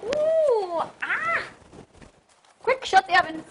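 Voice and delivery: high startled cry at oven heat; hot yelp. Real, not acted. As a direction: yelp as the heat hits you. Pulling hot cake from oven.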